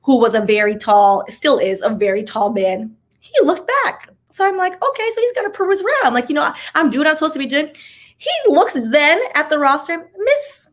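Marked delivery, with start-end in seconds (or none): 3.2-7.7 high-pitched, rushed nervous retelling; tense retelling. Retold or imitated. Telling viewers how the professor stared, voice high and quick. 10.1-10.7 high, drawn-out call of a name; professor calling name. Retold or imitated. Professor in class calling on a student, pitched high.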